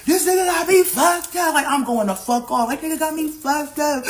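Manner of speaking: high-pitched mock-tearful wail; imitated crying. Pregnant woman retelling her upset to mom, imitated crying. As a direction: act out an emotional crying rant. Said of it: "So I'm crying like".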